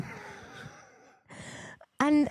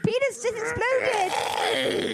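slow mock shout; 8 semitones higher, much slower than usual. Crew member explodes.

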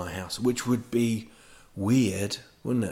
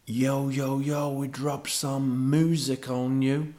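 mock Texan drawl, higher and louder; Texan gangster rapper; 6 semitones higher, 5 dB louder, slower than usual. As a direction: draw it out like a Texan rapper hyping a crowd. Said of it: "talking like gangster rap kind of".

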